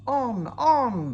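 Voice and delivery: high, loud gleeful cry; excited cry. Acted. Runaway trucks cry out to each other, high and loud. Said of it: "they cried".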